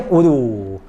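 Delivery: ordinary delivery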